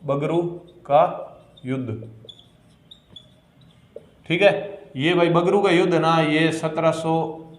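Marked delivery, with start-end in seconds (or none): 0.0-2.1 slow dictation. Shown as a demonstration. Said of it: "कौन सा युद्ध होता है?".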